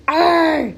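high animal "arr" cry; high growl. A narrator's reading. Squirrel facing the shovel, high growl.

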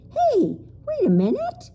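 put-on high child's voice, objecting; a little girl pushing back; protesting girl. A narrator's reading. Lindy protesting to the Whangdoodle, high child's voice. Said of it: "Lindy protested".

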